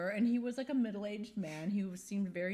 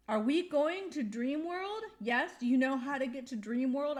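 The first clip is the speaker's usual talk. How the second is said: overly polite question; 6 semitones higher, 5 dB louder than usual. Retold or imitated. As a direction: ask sweetly so you don't offend. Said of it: "I'm trying to be nice to this man".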